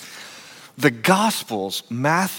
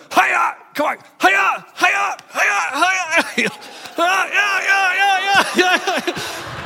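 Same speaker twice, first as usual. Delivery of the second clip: karate yells; much slower than usual. Acted. Pastor acting out the master's attack on his student, high karate shouts. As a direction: fire off hammed-up "hiya" chops in a high yelp. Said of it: "He starts going".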